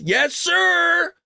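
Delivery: high, drawn-out catchphrase; gleeful hype. Real, not acted. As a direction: crow it high and stretch the last word. Gleeful catchphrase on ballot box news.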